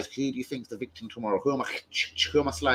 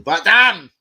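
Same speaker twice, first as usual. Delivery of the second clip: excited exclaim; 8 semitones higher, 12 dB louder than usual. Real, not acted. Reacting to bars, exclaims.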